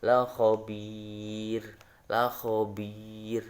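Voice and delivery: la khobir drawn out very slowly, thin ro at the end; teacher recites the example to eighth graders; Quranic recitation. Shown as a demonstration. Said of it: "maka dibaca tipis".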